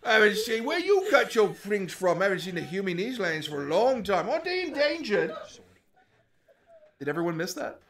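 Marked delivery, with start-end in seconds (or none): 0.1-5.3 loud, cackling goblin rider; laughing hobgoblin. Acted. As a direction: boom it out with a mocking laugh.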